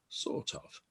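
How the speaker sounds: "sort of" whispered in an English accent. Shown as a demonstration. Teacher whispering the English "sort of" as a demo. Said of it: "in an English accent".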